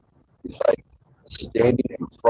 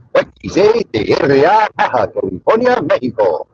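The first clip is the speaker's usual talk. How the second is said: high, loud imitation border-radio ID; mock station call, place names stretched out; radio station announcer; 7 semitones higher, 6 dB louder than usual. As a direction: belt it out like a radio jingle announcer. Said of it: "you hear that, you're from Southern California".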